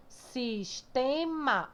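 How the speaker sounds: SISTEMA read slowly, syllable by syllable; separated syllables. Shown as a demonstration. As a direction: split SISTEMA into drawn-out syllables. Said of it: "Mas eu vou ler, vou separar".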